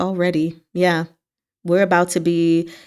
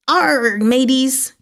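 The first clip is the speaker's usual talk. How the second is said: drawn-out mock pirate "arrr", pitched up; higher, slow hammed-up pirate; pirate call; 7 semitones higher, 3 dB louder, much slower than usual. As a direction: play a jokey pirate hailing the crew. Jokey pirate call punning on R.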